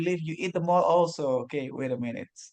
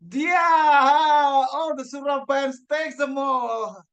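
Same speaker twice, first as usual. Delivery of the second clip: high, slow, shouted grand reveal; pageant host; 11 semitones higher, 5 dB louder, slower than usual. Acted. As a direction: build up the winner like a pageant host. Announcing table topic winner.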